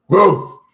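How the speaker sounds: high, loud bark; loud dog bark. Acted. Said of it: "he barked".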